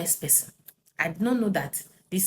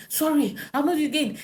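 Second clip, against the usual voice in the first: higher pleading husband; 9 semitones higher than usual. Retold or imitated. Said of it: "He was saying".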